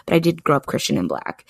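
usual speaking voice

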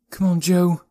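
put-on high boy's voice urging himself on; talking to himself. A narrator's reading. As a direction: gee yourself up, pitched high. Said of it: "he said to himself".

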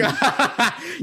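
high-pitched mocking cackle. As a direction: re-enact a gleeful cackle. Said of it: "I'm cackling at this point".

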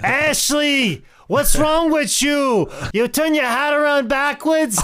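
high, loud hammed-up Italian relative; shrieky mock-Italian scolding, drawn out; Italian accent. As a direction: holler like an exasperated Italian relative. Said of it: "Italian accent, I would just sound like".

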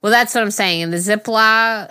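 usual speaking voice

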